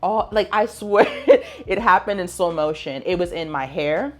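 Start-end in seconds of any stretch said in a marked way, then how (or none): 1.1-1.6 short bright burst of laughter; a quick laugh at her own bad luck; quick high laugh. Real, not acted. Laughing at getting splashed with coffee, a quick high laugh.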